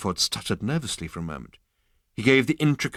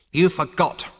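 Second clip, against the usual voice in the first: snapped; 7 semitones higher, 5 dB louder than usual. A narrator's reading. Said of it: "snapped Zaphod Beeblebrox the fourth".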